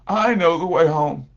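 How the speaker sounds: preacher voicing the elder brother, fervent and loud; oldest brother. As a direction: call him home with rising urgency. Oldest brother voiced in parable.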